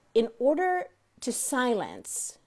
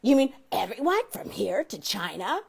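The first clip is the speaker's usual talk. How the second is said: mock incredulous; 4 semitones higher, 4 dB louder than usual. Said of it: "I say".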